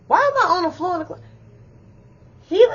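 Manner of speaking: high, fast, rushed disbelief; baffled panic. Retold or imitated. Waking up on the closet floor, high rushed panic. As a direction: blurt it out in confused alarm.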